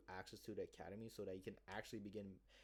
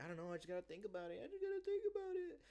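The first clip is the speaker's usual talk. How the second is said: high, fast hesitant girl voice mocking her; mock Sarah; 13 semitones higher than usual. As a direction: play the indecisive client in a squeaky put-on voice. Voicing Sarah's hesitant reply.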